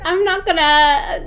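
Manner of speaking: loud, high, slow words through laughter; laughing. Real, not acted. Guest dodging the paddle-print remark, laughing loudly. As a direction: refuse while cracking up.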